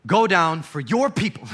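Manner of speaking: a hurt God ordering Moses down; hurt God's voice. Retold or imitated. God telling Moses to go down, hurt and loud. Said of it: "you can almost hear his tone switch".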